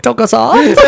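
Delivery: high, laughing delivery; cracking up mid-line. Real, not acted. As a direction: say it through a burst of laughter. Joking with host amid shared laughter.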